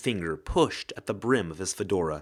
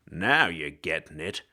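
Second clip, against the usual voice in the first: hard-boiled ghost; 5 semitones lower than usual. Acted. Ghost detective voice.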